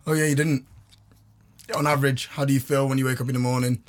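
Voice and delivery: ordinary talk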